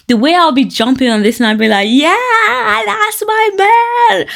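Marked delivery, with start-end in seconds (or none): 1.9-4.3 high, drawn-out whoop of pride; excited cheer. Acted. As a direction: cheer for your man, voice way up. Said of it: "the way I'll be excited".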